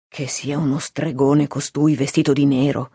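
plain reading voice

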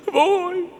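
high, loud, overwrought disbelief; shaken, alarmed astonishment; Lucia, trembling. A narrator's reading. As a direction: stammer out questions in a high female voice. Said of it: "replicò ancor più tremante Lucia".

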